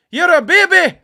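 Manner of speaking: high Scottish yell; Scottish accent. Acted. Fan jeering as John McGinn appears, yelled Scottish accent. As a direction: bellow it as a taunting Scot. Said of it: "Not a bad accent".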